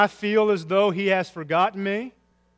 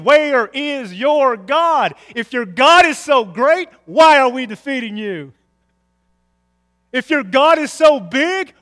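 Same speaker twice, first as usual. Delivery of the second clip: loud, high, drawn-out taunt; mocking taunt; 8 semitones higher, 11 dB louder, slower than usual. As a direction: jeer at a believer, stressing each challenge. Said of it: "They mock him by saying".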